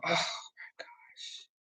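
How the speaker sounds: breathy whispered exasperation; hushed, fed-up aside to viewers. Real, not acted. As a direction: whisper it in frustration, slowly. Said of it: "frustrated whispered aside".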